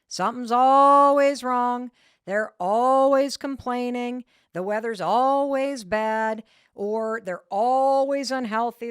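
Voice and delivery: loud, drawn-out stress on "always"; exaggerated ALWAYS. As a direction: lean hard and long on every "always". Mimicking people who always complain.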